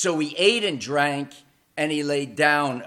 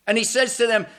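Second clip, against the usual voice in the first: ordinary talk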